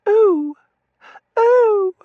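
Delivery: very high, loud "oh"; piercingly high, drawn-out cries; affected little squeals. A narrator's reading. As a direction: squeal daintily like a Victorian heroine. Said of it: "make it sound exactly like I'd seen it written".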